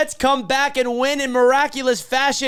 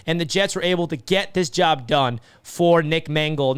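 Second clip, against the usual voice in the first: ordinary talk, nothing marked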